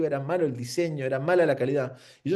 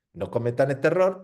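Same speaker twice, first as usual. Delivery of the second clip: ordinary talk, nothing marked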